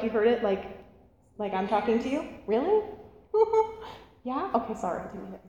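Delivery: ordinary talk, nothing marked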